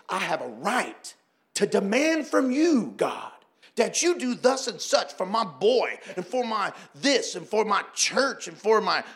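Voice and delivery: mildly mock a demanding lady.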